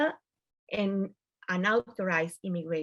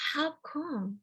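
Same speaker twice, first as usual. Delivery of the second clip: puzzled; 7 semitones higher, 5 dB quieter than usual. Retold or imitated. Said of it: "It was like".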